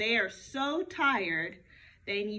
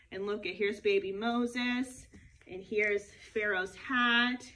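usual speaking voice

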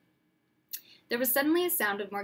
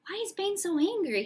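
put-on young boy; 9 semitones higher, 3 dB louder than usual. Harry asking, boy voice.

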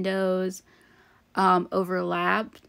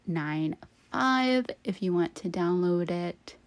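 ordinary delivery

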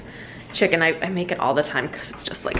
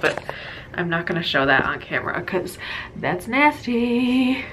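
normal talking voice

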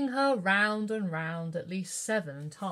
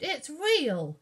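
put-on higher, louder amazed child; astonished; 7 semitones higher, 4 dB louder than usual. A narrator's reading. Katie inside the painting, amazed and loud. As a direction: gasp out the discovery. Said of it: "cried Katie, astonished".

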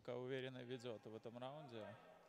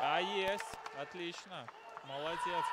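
jubilant shout, much higher and louder; wrestling commentator; 6 semitones higher, 14 dB louder, slower than usual. Real, not acted. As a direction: roar with joy at the submission. Commentator at the finish.

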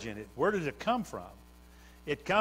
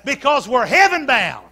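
louder, ringing preacher's cadence; full-force preaching; 6 semitones higher, 11 dB louder than usual. Acted. Preacher stirring the church toward heaven, full-force preaching.

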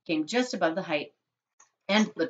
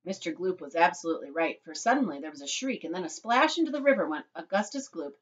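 ordinary delivery, nothing marked

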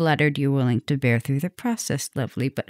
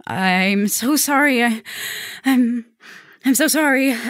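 anguished apology; 6 semitones higher than usual. Acted. Vampire confesses turning his date.

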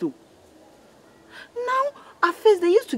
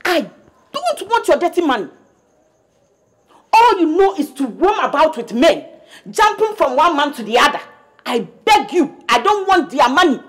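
loud, bitter rant; mother shaming daughter, loud and furious; angry scolding; 4 dB louder than usual. Acted. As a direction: tear into her with contempt.

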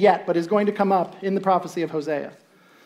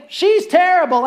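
judging scorn; 10 semitones higher, 6 dB louder, slower than usual. Acted. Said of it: "see her and think".

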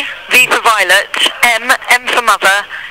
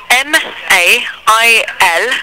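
slow spelling; much slower than usual. Real, not acted. Repeats spelling for confused caller.

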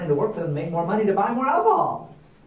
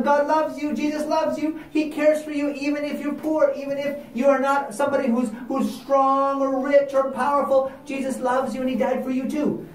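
gentle preaching; 8 semitones higher than usual. Acted. Missionaries preaching to the Indians.

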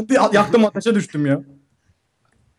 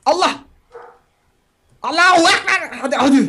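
high shouted battle cry; 9 semitones higher than usual. Real, not acted. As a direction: yell it like charging in. (savaş narası)

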